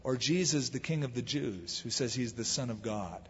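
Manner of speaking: dropped low and soft; quiet aside. Retold or imitated. Pilate quietly slips Jesus in as the other choice, soft.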